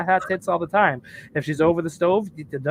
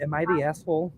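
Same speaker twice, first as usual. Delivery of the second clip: ordinary talk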